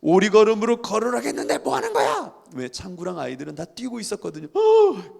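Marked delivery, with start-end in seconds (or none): 0.0-2.3 angry shout rising high and loud; imitated teacher yelling. Teacher shouting at cheating pupils from afar, acted yell. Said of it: "소리, 소리 지르면서 오는 거예요". 4.5-5.2 very high, loud startled yelp; mock alarm. Running pupils caught by teacher, imitated alarmed yelp.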